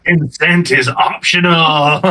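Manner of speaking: laughing. Real, not acted. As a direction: stretch it out into a laugh. Joking about kidnapping, group laughing.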